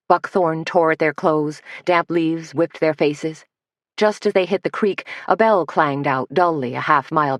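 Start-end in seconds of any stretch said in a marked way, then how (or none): none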